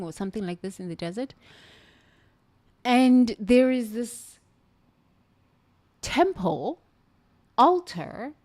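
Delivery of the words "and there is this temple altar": excited. Real, not acted. Describing the desert dome.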